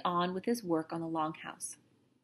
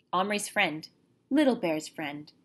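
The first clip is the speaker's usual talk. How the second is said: plain reading voice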